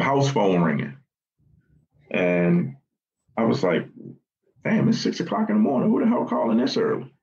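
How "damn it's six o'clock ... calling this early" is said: high, fast, irritated mock complaint; retelling being rattled by an early call, fast and high-pitched; annoyed grumble. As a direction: grumble it up high and quick, imitating being woken.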